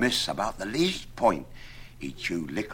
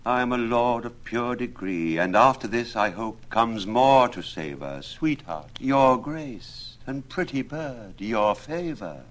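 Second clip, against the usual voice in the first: higher, grand pleading lover; kneeling suitor's plea; 5 semitones higher, 5 dB louder than usual. A narrator's reading. Absalom kneels at the window pleading, higher and grand. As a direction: plead on his knees with mock grandeur.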